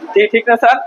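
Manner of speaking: ordinary talk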